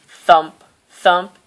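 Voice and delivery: usual speaking voice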